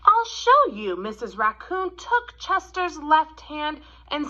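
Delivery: gentle, expressive storybook read-aloud; reading to children. A narrator's reading. Teacher reading The Kissing Hand to kids, gentle storytelling. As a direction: read it like a picture book at story time.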